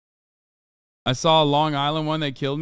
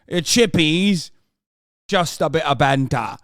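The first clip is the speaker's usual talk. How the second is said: slow, louder mock British geezer accent; 5 dB louder than usual. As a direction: ham up a British lad saying it. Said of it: "Terrible British accent".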